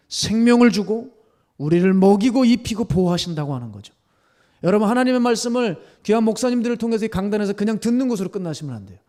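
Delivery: loud, high, forceful exhortation; ringing, urgent pulpit delivery; impassioned preaching. Real, not acted. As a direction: preach at full force to the congregation.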